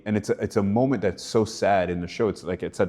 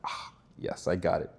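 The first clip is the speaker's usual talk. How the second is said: near-whispered "ah, yes" of quiet triumph; soft, almost breathless self-congratulation; satisfied hush; 3 semitones lower, 8 dB quieter than usual. Retold or imitated. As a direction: hush it like a private win. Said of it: "I'm like".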